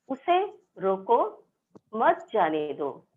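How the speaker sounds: slow उसे रोको, then a break before मत जाने दो; pause after रोको. Shown as a demonstration. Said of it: "उसे रोको" के बाद चिन्ह का उपयोग किया गया है".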